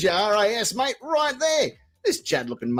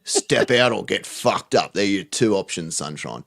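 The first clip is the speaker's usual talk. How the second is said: tough cop voice; 12 semitones lower than usual. Acted. Mock cop threat to driver.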